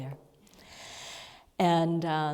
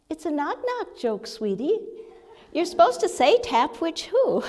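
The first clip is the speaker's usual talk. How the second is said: much higher, light and gentle, like a kindly spirit; sweet feminine guide voice; 11 semitones higher, 6 dB louder than usual. Retold or imitated. Retelling the guide's voice correcting her knock-knock confusion, high and gentle. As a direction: lift way up into a tender, amused guiding voice. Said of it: "this little sweet feminine voice say".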